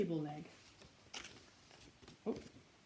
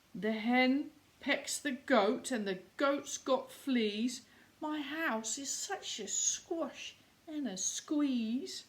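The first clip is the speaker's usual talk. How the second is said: plain reading voice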